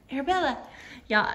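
ordinary delivery

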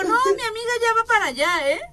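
high, fast imitation of her friend; mild high teasing voice; mock girly quote. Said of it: "Y a él le dijo".

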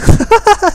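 high, loud burst of laughter. Real, not acted. Player cracks up at his own attempt, real laughter. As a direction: crack up at your own accent.

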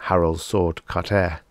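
usual speaking voice

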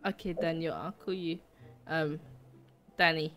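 soft filtered little nephew voice; voice changer. Pretend. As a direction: kid voice through voice changer. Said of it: "voice changer on".

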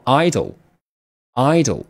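idle said slowly as EYE-dl, twice; teacher's slow model reading. Shown as a demonstration. Teacher reads idle slowly to the class, clear model pronunciation. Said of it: "第一个读作idle".

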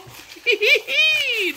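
loud high laughing shout; a wild happy cackle; shrieking laugh. Real, not acted. Laughing shout after hype.

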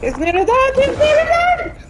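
high, loud, rising excitement; excited shout. Real, not acted. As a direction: shout it nearly dead. Boss nearly killed.

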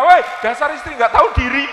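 a loud mock yell at the wife; hammed-up furious husband, loud and high; mock outburst the Prophet never made; angry shout. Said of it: "berdiri marah-marah".

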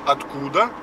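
ordinary talk

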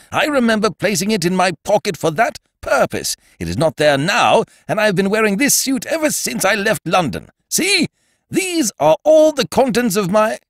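high, insistent protest; indignant Ukridge. Acted. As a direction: press the point in a high voice. Ukridge searching his pockets.

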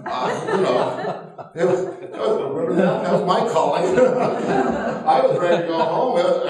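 laughing loudly through the words; can barely get it out for laughing; real laughter breaking through. Real, not acted. Laughing through toilet story.

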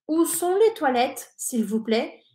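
"Où sont les toilettes, s'il vous plaît?" said clearly, rising on "plaît"; French model phrase. Shown as a demonstration. Teacher demonstrates the phrase to students, clear French. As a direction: model it for repetition. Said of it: "repetir conmigo todo lo que digo".

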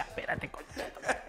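hushed retort. Retold or imitated. Wilmer answering the man shouting outside, hushed retort. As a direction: swear under your breath.

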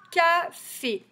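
Café in two separate beats, CA high and loud; syllable by syllable. Shown as a demonstration. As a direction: tap out Café as CA, FÉ. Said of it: "je le redis".

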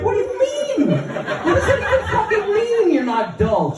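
very high, incredulous squeal; exasperated, pitch shooting right up; baffled outburst. Retold or imitated. Grandson to grandma after her odd retort, incredulous.

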